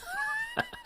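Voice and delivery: sudden high burst of laughter; a high, sudden laugh that breaks out. Real, not acted. Laughs at Avon MLM remark.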